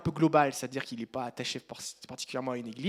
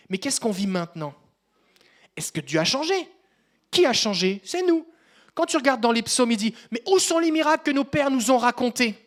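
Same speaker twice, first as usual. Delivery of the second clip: louder, higher preacher voice; preaching; 8 semitones higher, 10 dB louder than usual. Acted. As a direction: call out to the congregation. Preaching to the congregation.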